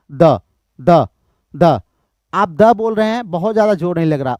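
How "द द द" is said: short, separated द; unstressed syllable demo. Shown as a demonstration. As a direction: say each द as one crisp beat. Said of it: "जोर जोर से थोड़ा बोलने की". (द को अलग-अलग, छोटा बोलें)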